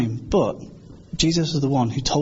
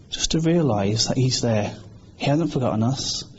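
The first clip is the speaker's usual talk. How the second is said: ordinary talk, nothing marked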